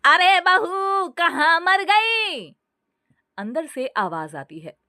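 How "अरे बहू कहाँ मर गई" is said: high, slow shouting mother-in-law; loud shout. A narrator's reading. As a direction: yell for the daughter-in-law across the house. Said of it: "जोर से चिल्लाते हुए बोली".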